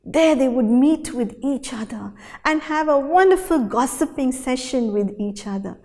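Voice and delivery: usual speaking voice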